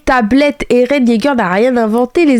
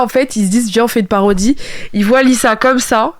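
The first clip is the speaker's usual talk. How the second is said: usual speaking voice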